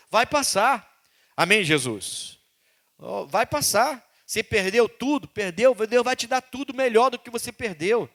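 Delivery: loud, rising preacher's promise; a fervent pulpit encouragement; encouraging preaching. Acted. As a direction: lift them with hope.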